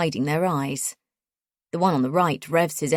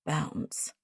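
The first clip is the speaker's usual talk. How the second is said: "Bounce" breathed unvoiced, far softer; quiet mocking echo; 3 semitones lower, 11 dB quieter than usual. A narrator's reading. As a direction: echo "Bounce" under your breath with disdain. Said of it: "Rez quietly echoes".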